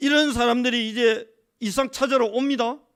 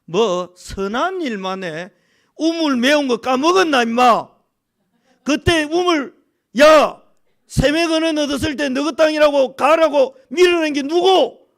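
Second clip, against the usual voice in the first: loud, higher, acted angry confrontation; 5 semitones higher, 9 dB louder than usual. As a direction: imitate an indignant victim shouting back accusations. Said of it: "우리 같으면 뭐라고 하겠습니까". (따지며 버럭 소리치는 연기)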